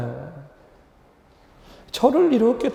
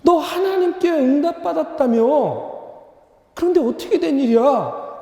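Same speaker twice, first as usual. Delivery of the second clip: retold angry confrontation, rising high; indignant questioning; 6 semitones higher than usual. Pastor confronts young man who claimed God's answer, indignant. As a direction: challenge him in mock indignation, pitch climbing. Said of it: "화가 막 치밀어 오르".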